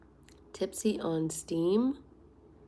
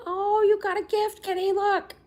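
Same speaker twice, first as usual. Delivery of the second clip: much higher and louder cooing at the cat; excited pet talk; 13 semitones higher, 10 dB louder than usual. Real, not acted. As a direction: gush to the kitty about the gift. Baby talk to cat over gift.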